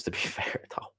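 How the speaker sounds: breathy laugh through the words, whispered. Real, not acted. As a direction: chuckle it out under your breath. Laughing aside about Britons.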